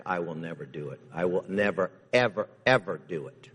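NEVER hit hard, then "ever, ever" piled on; "never" driven home, "ever, ever" stacked after it; emphatic never. Acted. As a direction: lean on "never" each time. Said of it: "That's an emphatic".

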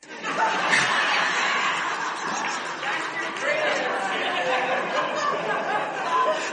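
high wheezing laughter; helpless laughing fit; breathless silent laugh. Real, not acted. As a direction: soundless giggling. Laughing at own confession.

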